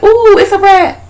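high excited squeal at the rat; squeaky delighted voice. Retold or imitated. Friend retelling the movie, doing Shitface thrilled by a rat, high squeal.

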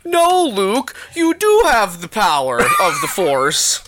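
high, stretched-out cartoon pep talk; hammed-up, high cartoon exhortation; weird cartoon voice. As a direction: encourage like a goofy cartoon mascot. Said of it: "cartoon voice would be like".

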